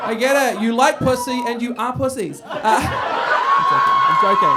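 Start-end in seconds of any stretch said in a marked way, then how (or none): 0.1-2.4 louder, higher sarcastic reply; teasing loud comeback to the men; mock exasperated retort. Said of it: "I'm like". 2.6-3.6 high shrieking laugh. Real, not acted.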